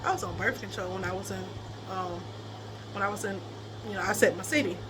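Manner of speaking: low, soft, halting confession; subdued admission. Real, not acted. Woman admitting birth control to viewers, subdued and halting. Awkward admission, trips over wording.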